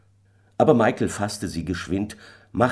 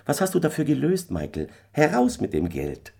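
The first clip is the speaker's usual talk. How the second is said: lightly voiced wife, higher and quick at first; impatient wife; 9 semitones higher than usual. A narrator's reading. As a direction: press the husband for an answer. Wife demands the money. (leicht angedeutete ungeduldige Ehefrau)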